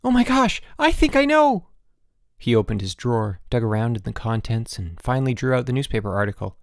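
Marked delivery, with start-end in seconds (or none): 0.0-1.7 high, loud shocked exclamation; gasped. A narrator's reading. Boots pretending to recognise the disease, gasping. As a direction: gasp it out high in alarm. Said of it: "Boots gasped".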